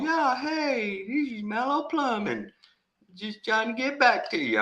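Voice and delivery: high, breezy put-on plumber voice; imitating a phone call. Plumbing company calling back, high breezy voice. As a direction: sound falsely chipper on the phone. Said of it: "and they call".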